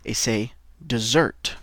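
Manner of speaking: "dessert" said slowly, rising; slow model pronunciation of "dessert"; teacher says "dessert" slowly for the class; model word. Shown as a demonstration. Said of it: "D E S S E R T".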